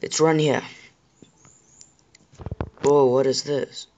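usual speaking voice

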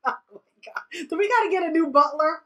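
loud, quick, high exclamation; excited disbelief. Real, not acted. Wondering aloud about a new butler, high and fast. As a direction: blurt it out amazed. Surprised laughing question.

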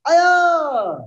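high, long kiai battle cry; drawn-out piercing martial arts yell; loud yell. Real, not acted. Instructor readying the class for kicks with a loud high yell. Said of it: "loud yell".

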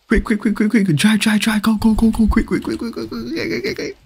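high, rapid quick-quick chant; urgent hurry. Real, not acted. Player urging teammate to speed up, rising panic. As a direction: hurry them along breathlessly. Urging a driver during a chase.

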